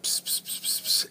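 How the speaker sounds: soft unvoiced psst sounds, barely there; whispered psst. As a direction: hiss psst psst into his ear like a teasing child. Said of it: "he would whisper in my ear".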